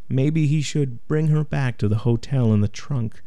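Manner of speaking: usual speaking voice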